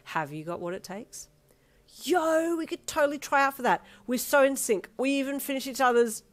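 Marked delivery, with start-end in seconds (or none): 2.0-6.2 high, loud and buzzing; excited pitch. Acted. Pitching the tryouts to Darby, excited and loud. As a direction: burst out with eager enthusiasm.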